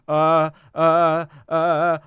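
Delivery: loud, stretched-out mock "uh"s; imitating stumped guy. Host mocks the guy failing to answer, exaggerated stumped stall. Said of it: "the guy was like".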